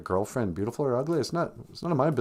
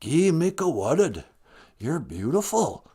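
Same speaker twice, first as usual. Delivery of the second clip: put-on lilting Rez accent, slowing at the end; singsong Rez-accented phrase; 2 semitones higher than usual. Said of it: "you're saying it with a Rez accent".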